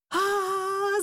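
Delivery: high, sustained "aah" scream; mother's scream. A narrator's reading. Mum shielding her son from the rat, screaming. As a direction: let out a long, high cry. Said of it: "закричала мама".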